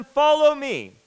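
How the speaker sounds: loud, ringing call to follow; firm command. Acted. Jesus calling the fishermen, loud commanding call. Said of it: "It, it's said in the imperative".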